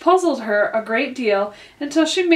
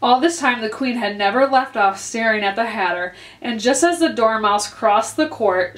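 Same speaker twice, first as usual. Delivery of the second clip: usual speaking voice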